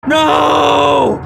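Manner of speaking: long, high, loud scream; full-force high cry; horrified scream. A narrator's reading. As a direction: scream it out in horror. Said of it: "I scream".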